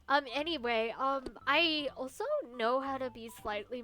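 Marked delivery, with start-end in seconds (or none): none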